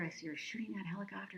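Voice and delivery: breathless whisper of excitement; excited whisper. Real, not acted. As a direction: whisper it like a thrilling secret. Excited recall of the action.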